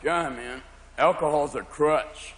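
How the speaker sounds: slow it right down, low and lazy; stoned friend. Acted. Pot smoker judging drinkers, slow stoner voice. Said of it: "friends who smoke marijuana going".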